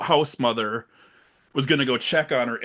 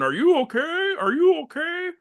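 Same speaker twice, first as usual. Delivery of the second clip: much higher, whiny mock killer voice; creepy boyfriend. Boyfriend calling to the hiding girl, high and whiny. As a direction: whine it like a creepy stalker. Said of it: "slinking around going".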